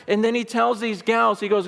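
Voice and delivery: usual speaking voice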